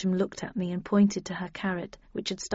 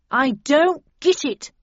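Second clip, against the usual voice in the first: high, loud, slow puzzlement; Snell's puzzled voice; 6 semitones higher, 6 dB louder, slower than usual. A narrator's reading. Snell staring at the chaos in the laboratory, slow high-pitched puzzlement. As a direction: draw it out, pitched up. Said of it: "murmured Snell".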